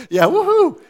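high, loud laughing woohoo; sudden joyful whoop; excited cheer. Real, not acted. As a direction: whoop it up like a fan. Crowd cheers free coffee day.

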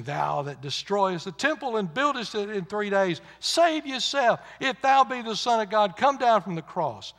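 preacher imitating jeering passers-by; reviling taunt. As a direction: hurl it as a scornful dare. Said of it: "they that passed by reviled him".

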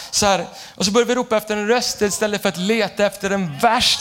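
ordinary talk

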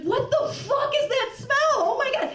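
high, loud mock scream of disgust; retold scream. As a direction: yell it, shocked by the stench. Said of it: "starts, like, screaming".

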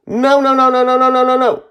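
put-on very high, loud child's screaming; frantic refusal. A narrator's reading. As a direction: fire off panicked noes without let-up.

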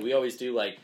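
ordinary talk

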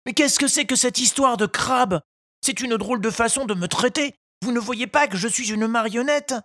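high, indignant little puppet; an offended wooden boy protesting; irritated puppet. A narrator's reading. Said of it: "Pinocchio intervint irrité". (voix de pantin irrité, aiguë)

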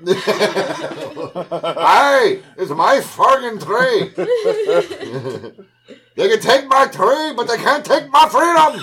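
high, loud, slow battle cry; hammed-up outraged Scottish rallying shout; mock Scotsman yelling. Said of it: "a guy in a skirt yelling at you".

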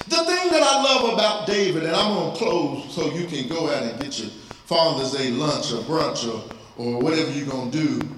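normal talking voice

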